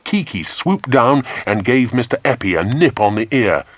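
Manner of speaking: usual speaking voice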